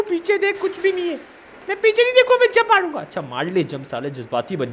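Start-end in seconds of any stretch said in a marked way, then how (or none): 1.7-3.0 high squeaky pet-like voice, pitched well up; Pappu voice. Pretend. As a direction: play the little creature whining. Pappu answers. (Pappu की ऊँची पतली आवाज़)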